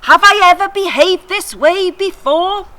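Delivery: loud, high, indignant rant; ranting back. Acted. Donkey rants back at her master, loud and high. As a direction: shout your hurt right back at him. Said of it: "So I ranted back".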